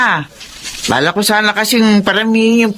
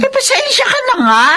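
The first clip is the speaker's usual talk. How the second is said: whiny apology. Acted. Mother apologizes after gambling loss.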